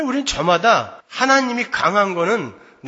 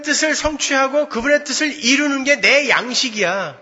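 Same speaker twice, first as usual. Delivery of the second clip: loud, high fervent sermon climax; full-voiced revival preaching; preaching at full force; 8 semitones higher, 6 dB louder than usual. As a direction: drive it home from the pulpit.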